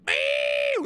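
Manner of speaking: high loud cat yowl; wild yowl. Pretend. Imagining running through Kentucky hills, yowling loud.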